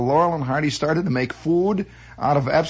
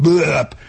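loud, abrupt machine blurp; imitated plop; 6 dB louder than usual. Storyteller imitating custard coming out, plopping noise.

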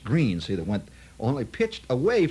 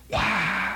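imitated crowd roar; 10 semitones higher than usual. Crowd roars at the hole-out.

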